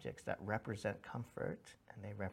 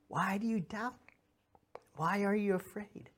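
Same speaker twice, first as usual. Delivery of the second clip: gentle Jesus reassuring; 9 semitones higher than usual. Acted. Jesus reassures frightened disciples.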